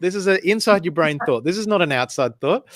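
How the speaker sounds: high, frantic self-scolding; panicked inner voice. Retold or imitated. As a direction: hush yourself in a panic. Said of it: "in my mind, I was like".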